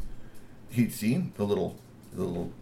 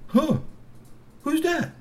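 high startled troll voice; slower than usual. A narrator's reading. Troll sees himself on video.

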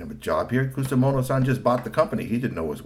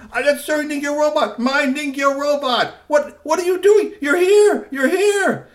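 very high, loud cry; finding his lost robot; overjoyed inventor; 7 dB louder than usual. A narrator's reading. Ningyoshi seeing his robot, overjoyed. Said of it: "he said".

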